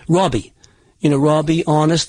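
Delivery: "Robbie" and "honest" with a rounded aw for the O; rounded aw vowel. Shown as a demonstration. Coach showing certain Boston vowels, "Robbie" with rounded aw. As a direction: round the first vowel of "Robbie" towards "Rawbie". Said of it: "kind of a rounded aw sound".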